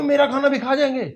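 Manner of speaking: put-on a worried kid afraid for his dinner; small boy worried about losing his food, high and alarmed; high alarmed child.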